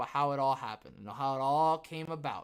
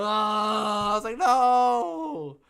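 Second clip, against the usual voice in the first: high, loud drawn-out no; mock despair yell; 7 semitones higher, 12 dB louder, much slower than usual. Retelling YouTube's claim with a hammed-up no. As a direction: wail it like a cartoon defeat.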